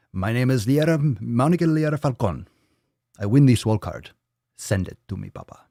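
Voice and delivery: put-on winner's name declared in a Spanish accent; mock Spanish accent. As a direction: proclaim it in a hammed-up Spanish accent, softening at the end. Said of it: "combining all sorts of languages and movie references".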